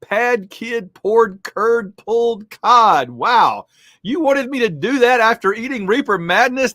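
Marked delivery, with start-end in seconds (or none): 0.0-3.1 slow, deliberate tongue twister; slow careful tongue twister. Shown as a demonstration. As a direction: enunciate each word slowly. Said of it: "Tongue Twister Madness".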